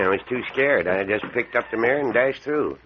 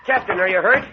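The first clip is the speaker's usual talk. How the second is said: alarmed; 9 semitones higher, 4 dB louder than usual. Acted. Captain knocked down.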